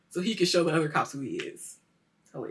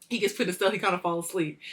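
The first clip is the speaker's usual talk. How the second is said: ordinary talk, nothing marked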